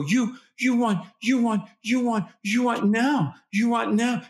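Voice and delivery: put-on high, chopped baby-talk phrases; toddlerese. Shown as a demonstration. Parent echoing a one-year-old's wants in high baby talk. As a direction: talk like the toddler, short and repeated. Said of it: "point and gesture and go".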